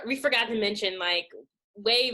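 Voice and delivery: normal talking voice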